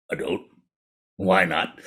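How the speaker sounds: low, soft tiger voice; low storybook big cat; skeptical tiger. A narrator's reading. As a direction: question the frog, unimpressed. Tiger questions the frog.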